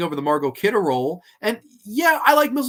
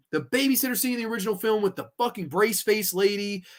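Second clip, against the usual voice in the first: normal talking voice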